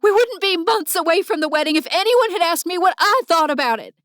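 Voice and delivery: raw, high shout. Acted. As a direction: blurt it out in a raw yell. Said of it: "I shouted".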